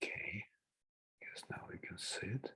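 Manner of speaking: whispering to himself, very soft; half-whispered aside while fiddling with screen. Real, not acted. Muttering to himself while screen-sharing.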